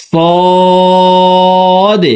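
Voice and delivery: সদ said thick and full, held high; teacher voices a heavy সদ; heavy sad. Shown as a demonstration. Teacher demonstrates to viewers, heavy full সদ. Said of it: "মোটা করে উচ্চারণ করব".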